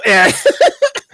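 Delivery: high laugh. Real, not acted. Laugh after joke.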